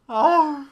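high, loud angry "ah"; loud frustrated shout. Real, not acted. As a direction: bark out in frustration. Frustrated gamer cries out.

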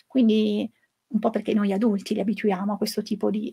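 ordinary talk

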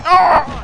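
high, strained cry of being beaten; loud play-acted grunt of pain; straining pain groan. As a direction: groan like you're straining on the toilet. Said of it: "the noises you make when somebody beats".

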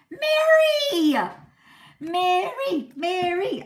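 high, loud excited call of the name; shouting the winner's name in delight. Real, not acted. Announcing live giveaway winner.

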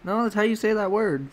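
high-pitched mock insisting; exaggerated high-voiced correction; mock insistence. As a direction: imitate an indignant know-it-all, pitched high. Acted pronunciation argument.

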